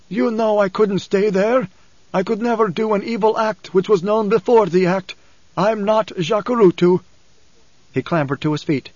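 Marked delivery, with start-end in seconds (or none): 0.1-7.0 high-pitched, strained father's voice; wounded father justifying himself; Paul, defensive. A narrator's reading. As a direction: plead your case in a higher, anguished voice.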